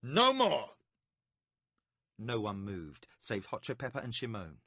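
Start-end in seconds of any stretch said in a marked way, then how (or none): 0.0-0.8 sharp, loud, higher command; commanding stop. A narrator's reading. Magician halting the arena games, loud command. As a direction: slam the crowd to silence. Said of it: "the magician said".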